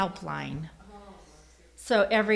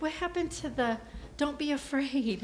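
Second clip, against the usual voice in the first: mildly incredulous, pitched up; retold bafflement; 6 semitones higher than usual. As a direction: question it with mock confusion. Retelling her baffled reaction.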